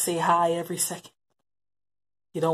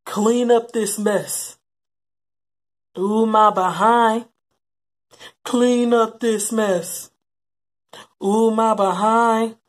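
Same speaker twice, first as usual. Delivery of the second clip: mock crying; 3 semitones higher, 7 dB louder, slower than usual. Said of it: "We just to cry".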